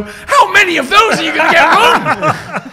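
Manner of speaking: loud, high, drawn-out mock outrage; high-pitched exasperated yell; incredulous outburst. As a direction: exclaim it slowly in comic disbelief. Mock outrage at film's dialogue.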